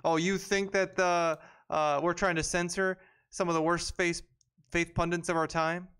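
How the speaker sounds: high, incredulous mock question. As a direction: imitate a writer ridiculing someone's worry. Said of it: "this guy's trying to make fun of Joe Rogan to say".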